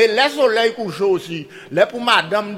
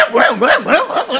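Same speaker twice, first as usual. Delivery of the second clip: high, loud run of pig squeals; imitated pig squeals; 6 semitones higher, 5 dB louder than usual. Storyteller as the pig heard in the woods, squealing. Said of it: "cochon qui a crié".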